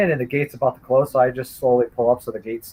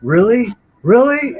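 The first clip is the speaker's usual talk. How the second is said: rising, louder outraged "Really? Really?"; enraged disbelief; 7 semitones higher, 5 dB louder, slower than usual. Retold or imitated. Man enraged at the attacker, furious shout. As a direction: hurl the words in furious disbelief. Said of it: "I got so enraged".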